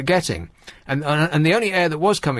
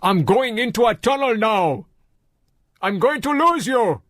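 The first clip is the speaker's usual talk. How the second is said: imitated Nigerian-Welsh accent; 6 semitones higher than usual. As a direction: imitate a Nigerian-Welsh man yelling into his phone. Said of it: "Shall I do the accent".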